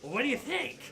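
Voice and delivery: high-pitched goblin retort; irritated goblin. Acted. Goblin answering intruder's question, irritated high voice. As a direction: answer back as a testy goblin. Goblin snaps back at the player.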